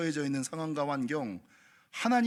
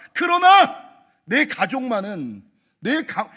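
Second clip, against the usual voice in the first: ordinary talk, nothing marked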